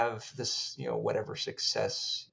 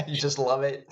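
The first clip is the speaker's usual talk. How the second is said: words spilling out through laughter; laughing teasingly at a friend; laughing tease; 3 semitones higher, 4 dB louder than usual. Real, not acted. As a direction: tease him while cracking up. Teasing the pained writer.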